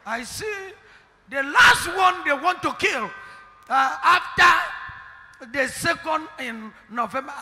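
loud, rising prophetic proclamation; forceful preaching. Real, not acted. Prophet to the church, a forceful proclamation. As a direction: announce the dates like a warning.

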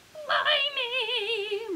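high, loud pirate exclamation; little pirates. A narrator's reading. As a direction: cry out like a put-upon little pirate. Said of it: "mumble her little pirates".

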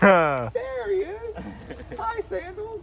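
very high, drawn-out mock delight; excited show host. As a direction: squeal a greeting to a puppet friend. Acts out spotting the monkey.

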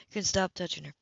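usual speaking voice

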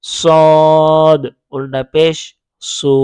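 very slow stretched chant, letter name held long; drawn-out spelling. Shown as a demonstration. Teacher drilling the letter for learners, very slow stretched chant. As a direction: stretch each part out slowly. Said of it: "তখন আপনি বানান করে পড়েন".

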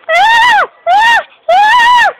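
three short, loud, high shrieks; high-pitched screams. Shown as a demonstration. Kid showing high pitch voice, repeated mock screams. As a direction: demo repeated piercing screams. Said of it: "that's a high pitch voice".

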